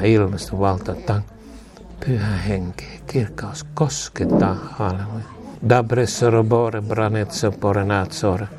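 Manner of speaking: hushed glossolalia blessing. Acted. Low prayer in tongues.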